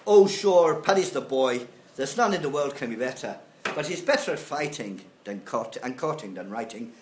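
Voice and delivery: put-on lyrics read in a lilting Irish accent, the opening "Oh sure" called out loud. Lecturer reading song lyrics to class in an Irish accent. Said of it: "mon accent irlandais".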